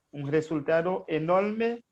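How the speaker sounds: ordinary talk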